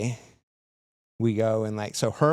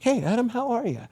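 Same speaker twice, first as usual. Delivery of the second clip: high, bright hammed-up greeting; cheery officer voice; 13 semitones higher than usual. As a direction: play the clueless jolly cop.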